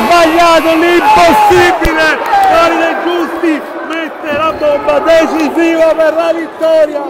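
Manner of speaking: screaming ecstatic buzzer-beater call; breathless italian goal commentary. Real, not acted. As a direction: yell it like the winning shot.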